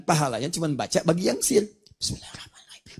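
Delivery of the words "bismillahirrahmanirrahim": whispered basmalah, barely voiced; silent recitation. Shown as a demonstration. Teacher shows silent reading, whispered. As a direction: breathe it out for yourself alone. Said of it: "Kalau disirkan".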